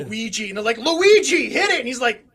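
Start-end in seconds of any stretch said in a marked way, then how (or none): 0.8-1.8 raised, higher call to a worker. Acted. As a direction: shout the cue to the guy in the back. Calling to worker in back.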